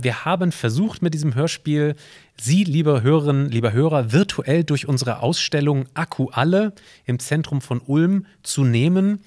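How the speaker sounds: ordinary talk, nothing marked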